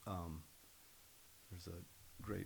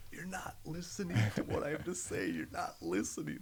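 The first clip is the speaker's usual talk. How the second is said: higher, louder exasperated telling-off; mock scolding; 7 semitones higher, 5 dB louder than usual. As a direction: snap back at nosy relatives. Imagined scolding of pushy relatives.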